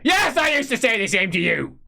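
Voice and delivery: high, loud retort; Tuberman, snappish. Acted. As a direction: snap back at Feuerbach. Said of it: "he says".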